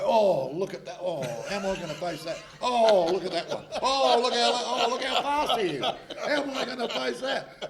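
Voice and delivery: mock the nervous batsman fretting over fast bowlers; hammed-up panicky moaning, laughing while doing it; nervous teammate impression. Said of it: "But he would sit there and go".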